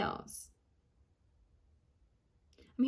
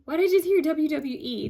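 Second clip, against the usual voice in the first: higher and louder, baffled; puzzled outburst; 5 semitones higher, 8 dB louder than usual. Real, not acted. Medium startled by a spirit message, louder puzzled exclaim. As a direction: blurt it out, surprised by the message.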